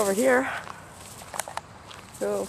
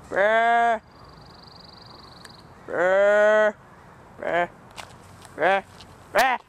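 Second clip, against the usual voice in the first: barking; 4 dB louder, much slower than usual. Pretend. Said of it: "I was barking".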